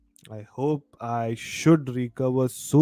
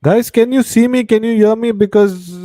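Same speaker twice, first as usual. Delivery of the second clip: calling out; 10 semitones higher, 9 dB louder than usual. Real, not acted. Mic check, asking if heard.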